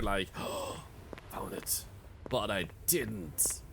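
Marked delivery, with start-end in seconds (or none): 0.4-1.2 quick voiceless gasp; soft gasp. Retold or imitated. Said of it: "got a little bit, like, excited". 1.3-1.8 whispered found it, voiceless; excited whisper. Retold or imitated. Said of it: "like, excited there".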